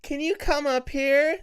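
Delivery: high, slow, sing-song fake mum voice; creepy imitation of a mother calling; mimicked mother calling. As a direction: lure them upstairs sweetly.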